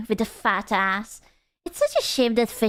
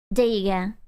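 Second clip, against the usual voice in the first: usual speaking voice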